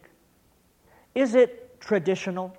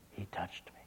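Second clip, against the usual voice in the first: even softer, fading whisper; whispered again; 10 semitones lower, 21 dB quieter than usual. Retold or imitated. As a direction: let the words almost vanish. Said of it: "looked up through her tears".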